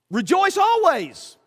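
slow, lifted command; emphatic command. Real, not acted. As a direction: proclaim it as an order. Preacher quotes the command.

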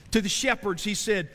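usual speaking voice

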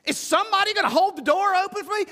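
high, fast whiny complaint; mock a put-out churchgoer demanding service; grumpy churchgoer. Preacher mimics a grumbling churchgoer, high whiny complaint.

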